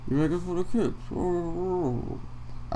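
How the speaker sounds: soft, mumbled mock accusation; Jake Paul. Mocking Jake Paul's complaint to Cody, soft dopey imitation. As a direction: mimic a dopey accuser, trailing into a drone. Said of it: "Jake Paul is just like".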